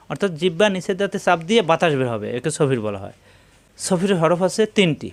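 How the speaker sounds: ordinary talk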